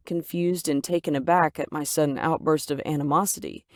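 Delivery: plain reading voice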